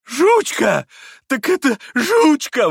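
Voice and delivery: very high, loud, stretched cries of bliss; blissful crying out. A narrator's reading. Captain seeing the lost dog, blissful high shouts. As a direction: shout the name in childlike rapture. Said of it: "выкрикивал он блаженным голосом".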